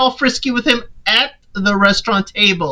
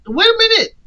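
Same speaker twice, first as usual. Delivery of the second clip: much higher, startled voice; shocked; 12 semitones higher than usual. Retold or imitated. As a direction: jump up in disbelief. Marcus stunned she leaves.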